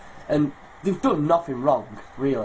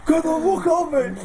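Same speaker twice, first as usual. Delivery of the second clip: high yelled mock shout; retold mock yell; 13 semitones higher, 4 dB louder than usual. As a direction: yell it like a kid fighting the police, half laughing. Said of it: "He's got".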